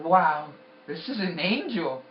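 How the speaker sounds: retold amazement. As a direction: marvel out loud at an angel. Retold amazed thought on seeing angel.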